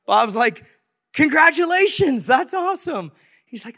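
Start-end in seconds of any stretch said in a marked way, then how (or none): 1.1-3.1 high, loud, hearty cheer; pitched-up, encouraging enthusiasm; enthusiastic congratulations. Retold or imitated. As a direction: imitate a delighted neighbor congratulating him. Retold cheering on of Ryan.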